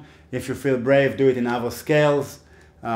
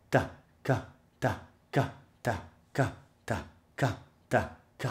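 quicker, softer ta ka pairs; faster syllable drill; 7 dB quieter than usual. Shown as a demonstration. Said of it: "A little bit faster".

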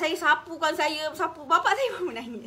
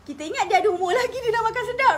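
higher, louder, crying through laughter; tearful; 9 semitones higher, 6 dB louder than usual. Real, not acted. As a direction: voice cracking with grief. Tearful over father's last meal. (menangis teresak sambil ketawa)

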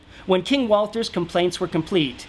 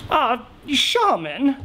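king voice; 6 semitones higher, slower than usual. Acted. King greets the shaman.

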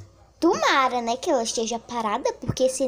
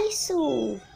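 ordinary delivery

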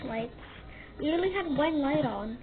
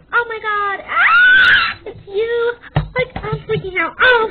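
loud, high, breathless fangirling; starstruck fan; 7 semitones higher, 9 dB louder, slower than usual. Acted. Fan meets the famous YouTuber, loud high fangirling. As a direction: gush like you're meeting your idol.

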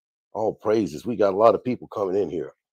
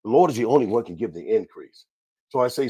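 normal talking voice

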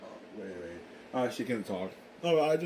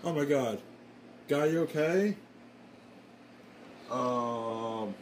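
usual speaking voice

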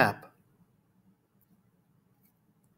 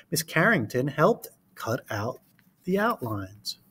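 put-on reading to children. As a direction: read it to a class of small kids. Reads picture book aloud.

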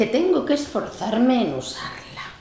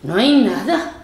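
ordinary delivery

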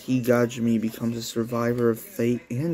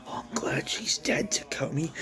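high eerie whisper; creepy whisper; 5 semitones higher, 6 dB quieter than usual. Acted. Psycho whispering to Takomi, creepy. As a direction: whisper it like a threat.

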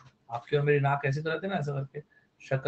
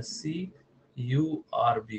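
teacher spelling a word; slow spelling out; 4 semitones lower, 6 dB quieter, much slower than usual. Shown as a demonstration. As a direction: spell सीयूआरबी letter by letter, slowly. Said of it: "curve बोलते हैं".

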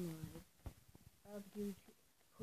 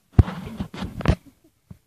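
loud giggle; 20 dB louder than usual. Real, not acted. Kid laughing at own joke.

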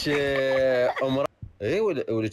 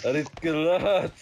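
laughing; 7 semitones higher than usual. Real, not acted. Laughing joke request.

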